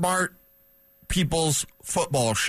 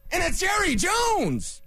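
mock awe; slower than usual. Mock awe at Jerry Jones.

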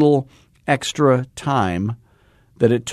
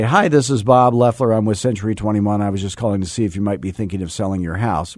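rushed cold call; faster than usual. Shown as a demonstration. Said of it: "rushing it because you're using the Mojo technology".

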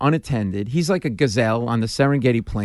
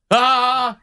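short startled yelp, pitched up; panicked yelp; 10 semitones higher, 4 dB louder than usual. Retold or imitated. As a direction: let out a sudden yelp of panic. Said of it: "This is the sound I made".

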